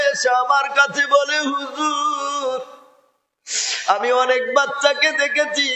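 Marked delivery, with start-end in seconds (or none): 1.5-5.7 put-on weeping child. Child crying, beaten by teacher.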